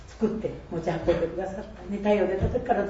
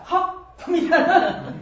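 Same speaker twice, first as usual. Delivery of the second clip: startled realization; 10 semitones higher, 5 dB louder, slower than usual. Retold or imitated. Realizes the person is behind.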